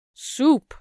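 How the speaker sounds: soup shouted high and loud; defiant shout of the word. Acted. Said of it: "shouted Cook".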